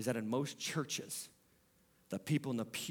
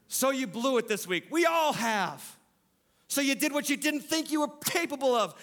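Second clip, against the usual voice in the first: higher, louder preaching, pressing the point home; full-force preaching; 11 semitones higher, 4 dB louder than usual. Acted. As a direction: preach it hard to the congregation.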